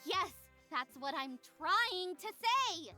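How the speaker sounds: slow, stressed exasperation; exasperated. Acted. Tabby agrees with the pixie, exasperated. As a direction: lean hard on the drawn-out words.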